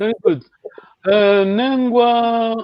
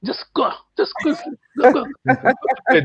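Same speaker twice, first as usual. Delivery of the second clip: higher-pitched, playfully cross dismissal; mock displeasure; 6 semitones higher than usual. Teacher playing someone not happy at bedtime, mock cross. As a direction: play at shooing someone off to bed. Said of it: "I'm not happy".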